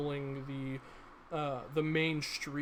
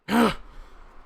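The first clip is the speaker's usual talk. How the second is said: sharp loud gasp; 7 semitones higher, 10 dB louder than usual. Acted. Man startled at the door.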